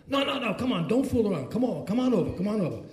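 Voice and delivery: high-pitched, pestering urging; retold insistent coaxing. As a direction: imitate a friend who won't take no for an answer. Retold friend insisting on phone.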